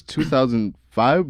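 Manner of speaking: slow, with FIVE lifted like a question; questioning rise. Retold or imitated. Girl unsure of her birth year, questioning rise on "five". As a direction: put a question mark on "five". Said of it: "with a question mark at the end".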